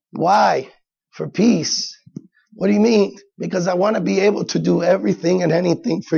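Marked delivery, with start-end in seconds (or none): none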